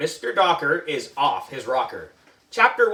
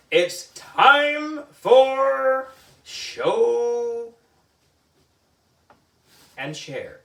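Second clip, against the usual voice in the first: slow, drawn-out, high sing-song; sing-song teacher announcement; 9 semitones higher, 3 dB louder, much slower than usual. Acted. Teacher announcing to class, slow sing-song call. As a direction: stretch it like a cheery teacher.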